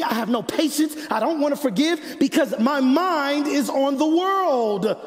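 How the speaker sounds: high, driving litany of frustration; heated preaching. Real, not acted. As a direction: pile up each phrase, urgent and insistent. Preaches own frustration in building list.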